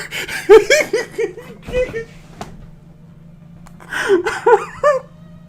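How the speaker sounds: loud, very high squealing laughter; long real laughing fit; helpless laughing fit. Real, not acted. Cracks up at the story.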